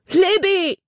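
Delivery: high, loud distant call; shouted name. A narrator's reading. Boy on the bank shouts to drowning girl. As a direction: call her name across the water. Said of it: "vernahm sie den Schrei".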